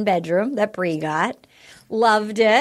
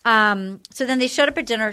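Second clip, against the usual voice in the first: ordinary delivery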